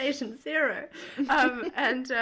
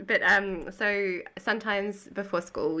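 ordinary talk

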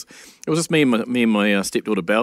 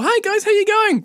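bright, much higher sing-song hello; cheery greeting; 4 dB louder than usual. Retold or imitated. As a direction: breeze in the door calling hello. Partner walks in greeting.

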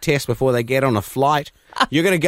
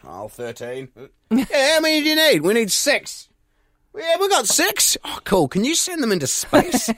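higher, bright acted shop chat; mock phone call; 12 semitones higher than usual. Astronauts calling the hardware store for brackets, higher playful voices. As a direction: play both sides of a silly hardware store call.

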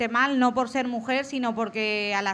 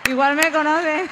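laughing quote. Retold or imitated. Retells own thought, laughing.

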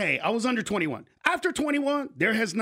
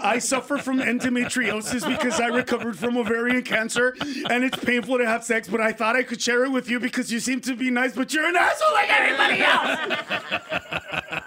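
raised voice rattling on, building to a shout; hammed-up outraged rant that ends in a yell; indignant tirade; 4 semitones higher than usual. Imagined woman tells off her tactless date, indignant, building to yelling.

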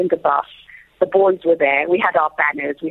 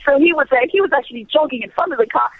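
ordinary talk

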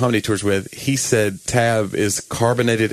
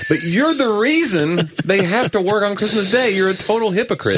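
mock indignation; 8 semitones higher than usual. Comic scolding Christmas Day shoppers, high mock indignation. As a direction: call out the hypocrite in a raised pitch.

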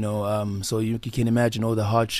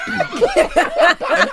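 high laughter; 7 dB louder than usual. Real, not acted. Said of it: "laughing mid-story".

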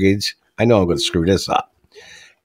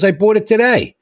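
put-on teen voice; 10 semitones higher than usual. Joke teller voicing the teen bragging about his car. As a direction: play the proud teenager. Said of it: "He goes".

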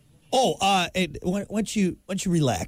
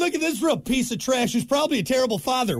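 mocking heckler; 7 semitones higher than usual. Heckler voice escalating.

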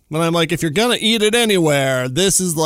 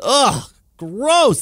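disgusted groan; 6 semitones higher, 3 dB louder than usual. Real, not acted. Disgust at the internet.

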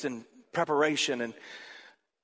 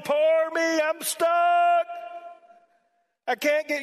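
high, whiny mock moaning; mock moaning, much higher and louder; self-pitying moan; 13 semitones higher, 5 dB louder, slower than usual. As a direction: whine like someone feeling sorry for themselves. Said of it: "You're just moaning".

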